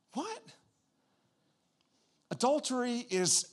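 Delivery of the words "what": soft, high-pitched mock "what?"; shocked disbelief. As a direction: squeak it quietly in disbelief. Acting out reaction to strong word.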